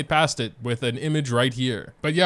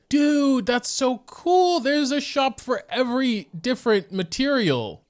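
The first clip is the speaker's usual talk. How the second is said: much higher, thrilled and drawn out; gushing over a cool new shop; excited planning; 12 semitones higher, slower than usual. Real, not acted. Thrilled at discovering the shops.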